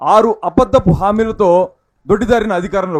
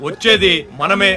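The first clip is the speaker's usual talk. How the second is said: rousing political slogan. Acted.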